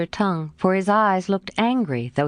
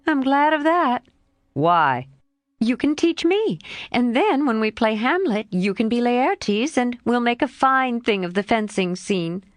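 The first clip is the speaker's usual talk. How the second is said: lightly voiced lively girl, a bit higher; a hint of a frank young girl, pitched up; Jo's voice; 6 semitones higher than usual. Jo answering her friend Laurie, lightly voiced girl.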